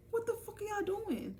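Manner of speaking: high, drawn squeal of disbelief; high-pitched outcry. Retold or imitated. Catching classmates with her book, high-pitched outcry.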